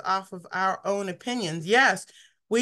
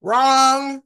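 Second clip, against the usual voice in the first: loud, firm verdict; a loud flat no; emphatic wrong; 3 semitones higher, 8 dB louder than usual. Real, not acted. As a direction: shoot it down hard. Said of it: "That's wrong".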